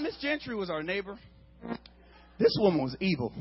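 usual speaking voice

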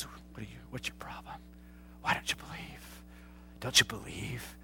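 hushed, intimate whispered rebuke. Retold or imitated. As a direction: lean in and whisper the challenge. Jesus asks disciples, whispered.

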